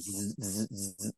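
put-on short buzzing bursts, like an old teleprinter; imitated wire-machine buzz. Storyteller mimicking the old AP wire, buzzing. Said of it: "the old school".

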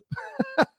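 high squeaky giggle; embarrassed laugh. Real, not acted. Host laughing after admitting a rainy makeout. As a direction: laugh it off, caught out.

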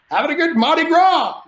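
high, loud, carefree holler; exuberant party yell. Retold or imitated. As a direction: whoop it up, brushing off the scolding. Partying, answers brother's scolding.

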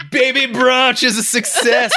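slow, high, laughing fake victory; mock triumph. Sarcastic dad declares the poopy baby brunch a win, laughing slowly. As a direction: announce it like a proud host through laughter. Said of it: "I was like".

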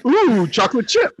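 high, excited cartoon quote; Donkey. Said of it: "Donkey walks by".

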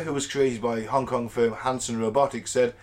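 normal talking voice

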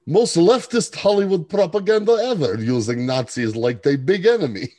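a hint of Eastern European accent reading a review; Russian accent. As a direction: read it in a mock Russian accent. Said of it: "I'll do the Russian voice this time".

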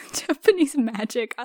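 whispered through laughter; laughing. Real, not acted. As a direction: giggle the words out. Repeats phrase laughing.

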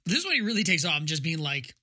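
ordinary delivery

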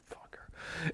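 soft whispered swearing; muttered curse. Retold or imitated. O J walking away from the standoff, whispered curse. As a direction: curse under your breath as you back down. O J backs down cursing.

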